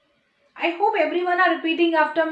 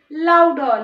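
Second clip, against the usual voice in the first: "louder" punched loud; "louder" drawn out, loud and falling; emphatic urging; 8 dB louder than usual. Shown as a demonstration. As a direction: hit "louder" hard after a short pause. Teacher urges children to speak up.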